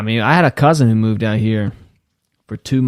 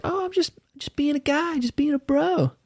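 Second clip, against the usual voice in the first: high, soft, innocent put-on voice; sweet fake innocence; mocking a bro; 6 dB quieter than usual.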